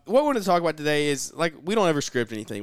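normal talking voice

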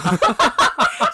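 laughing. Real, not acted. Friend laughs at own joke.

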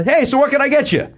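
high, sing-song mock barista greeting; mock sweet barista. Mimicking the barista cheerfully greeting the dentist. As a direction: chirp it like an oblivious cashier. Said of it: "So she says to him".

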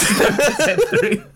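breaking into laughter mid-sentence; laughing. Real, not acted. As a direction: crack up as you finish the line. Cracking up at own joke.

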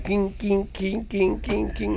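high, soft clink-clink of iron; playful metallic clinking sound; clanking metal noise. Retold or imitated. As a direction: mimic a metal belt clanging. Imitating clanking iron chastity belt.

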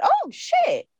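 high-pitched retold alarm; startled realisation. Retold shock at an unexpected jump.

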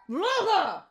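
high sharp call; high call to the barking dog to hush. Real, not acted. Calling the dog again.

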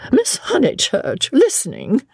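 high-pitched, cross disapproval; rather cross. A narrator's reading. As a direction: scold an eavesdropper in a high voice. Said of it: "she said rather crossly".

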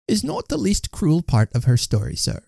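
high wife's voice; confiding wife. A narrator's reading. Missus Tugby telling the gentleman of a sad story, high voice. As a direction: answer in a high, earnest character voice.

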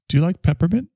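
high, sing-song query; lightly voiced sugary question; mock sweet question. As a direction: ask it with fake brightness. Mock chat with dismissive person.

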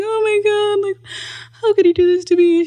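high, fast tearful wail; mock heartbroken cry; distraught. Crying girlfriend to his mom, high tearful wail. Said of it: "I was just sitting there crying".

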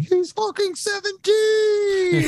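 screaming like a frontman on stage; hyped stage shout. Retold or imitated. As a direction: yell it to the crowd, drawn out. Stage announcer hyping young player.

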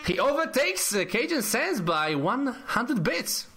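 high-pitched, amazed announcing; excited announcement. Real, not acted. Host announcing the new top donor, excited.

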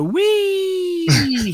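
high, long drawn-out whee; gleeful whee. Acted. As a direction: squeal it like riding a coaster. Punchline of roller coaster joke.

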